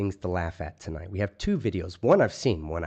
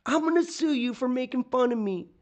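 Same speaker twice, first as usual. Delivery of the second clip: high, mocking imitation of a petty man; mock whiny threat; 13 semitones higher than usual. Mocking the man who tried to sue him, high whiny voice. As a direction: squeak it out like a sore loser.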